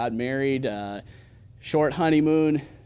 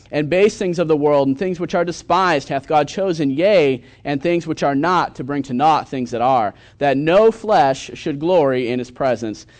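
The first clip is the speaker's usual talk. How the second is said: loud, ringing King James reading; preaching scripture aloud; 3 semitones higher, 7 dB louder than usual. Acted. Pastor proclaiming scripture to his new church, loud and ringing. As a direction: proclaim each verse to the congregation. Reads scripture at full force.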